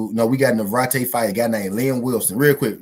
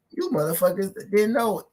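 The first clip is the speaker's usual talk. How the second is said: raised teasing singsong; playful high taunt; 9 semitones higher than usual. Acted. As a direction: tease them in a high voice. Hyping fight day to viewers.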